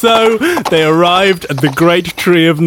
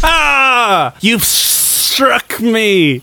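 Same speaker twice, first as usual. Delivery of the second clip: dying snake cry; 6 semitones higher, much slower than usual. Acted. Snake stabbed by sword.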